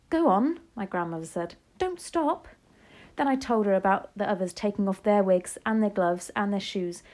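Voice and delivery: lively read-aloud with character voices; story narration. A narrator's reading. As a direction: read it to the class with feeling. Reads novel aloud to class.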